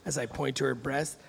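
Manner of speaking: normal talking voice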